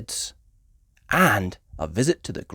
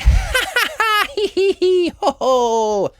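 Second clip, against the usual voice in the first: high giggle; 5 dB louder than usual. A narrator's reading. Peppa laughs after answer.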